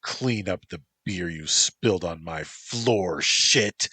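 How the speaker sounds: loud barked order; angry yell. Acted. Motoko yelling at Antropos to clean the floor, loud angry order. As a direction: bark the order down at him. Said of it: "she yelled".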